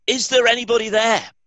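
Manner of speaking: high, loud cry for help up the cliff; desperate shout. A narrator's reading. Man dangling off a cliff shouts for help, loud and high. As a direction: yell upward, panicked. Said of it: "he shouted".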